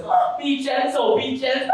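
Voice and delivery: high, loud swooning lover imitation; hammed-up girl pleading in passion; mock husky woman. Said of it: "while her voice sounds husky".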